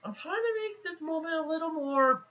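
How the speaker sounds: small, high, soft whine of protest; Cogman's voice. Retold or imitated. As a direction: imitate a little weirdo pleading his case. Cogman's retold excuse.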